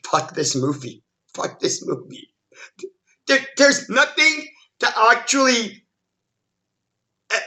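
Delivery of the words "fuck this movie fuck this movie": low, soft, fed-up aside; muttered disgust. Retold or imitated. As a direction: drop pitch and volume, muttering as you give up. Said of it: "That's when I went".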